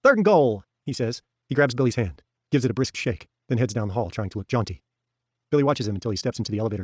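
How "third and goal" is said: sudden lift, higher and louder; brightens. A narrator's reading. Hoff answering Billy's football line, suddenly bright and louder. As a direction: perk up with a football call. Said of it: "Hoff brightens".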